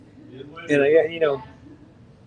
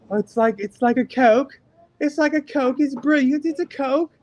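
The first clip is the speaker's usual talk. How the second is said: put-on much higher, excited English kid voice; mock British kid; 10 semitones higher than usual. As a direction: squeal it like a British kid tasting sweet tea. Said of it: "they're getting like".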